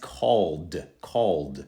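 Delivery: CALLED as one slow syllable ending in d; plain d ending. Shown as a demonstration. Teacher modelling the next word, d ending. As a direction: say CALLED twice, just a d on the end. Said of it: "simply into the D sound".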